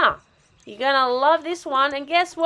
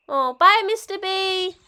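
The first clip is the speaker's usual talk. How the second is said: ordinary talk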